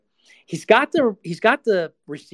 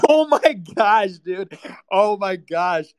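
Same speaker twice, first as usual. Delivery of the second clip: real laughter, slow and louder; laughing disbelief; 3 semitones higher, 7 dB louder, slower than usual. Real, not acted. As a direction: laugh through the shock. Reacting to the clip.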